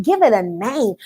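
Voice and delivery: ordinary talk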